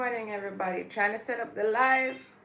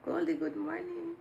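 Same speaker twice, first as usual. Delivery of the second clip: cooing to the cat; 7 semitones higher than usual. Real, not acted. Greeting her cat in the morning, a high tender coo. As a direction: sing it sweetly to Goldie.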